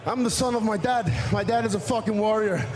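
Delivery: ordinary talk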